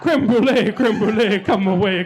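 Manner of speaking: high, loud, giddy cheering; very excited. Retold or imitated. Diner re-enacting cheering as dessert reaches the table, very excited. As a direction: cheer it out like a delighted kid. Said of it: "was very excited about it".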